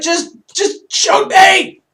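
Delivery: high, loud, slow mock cry of despair; a hammed-up wail of exasperation; exasperated shout. Host reacts to people blaming Zimmerman, mock exasperated shout. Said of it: "I'm like".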